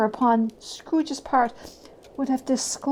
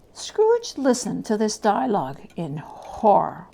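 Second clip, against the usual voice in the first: ordinary delivery, nothing marked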